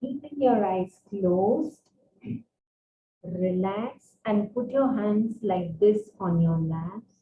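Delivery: soft, low meditation guide; soft spa whisper. Acted. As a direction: quietly guide breathing with hums. Leads guided breathing session.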